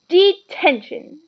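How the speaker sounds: loud high drawn-out shout. A narrator's reading. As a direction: bellow it at the student caught out of bed. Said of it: "she shouted".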